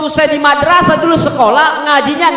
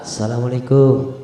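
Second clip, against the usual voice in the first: "Assalamualaikum" lower and much softer, falling; lower falling greeting; 13 semitones lower, 6 dB quieter than usual. Shown as a demonstration. The Prophet's second greeting, lower and softer. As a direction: drop "Assalamualaikum" lower and quieter. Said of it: "Turun intonasi yang kedua".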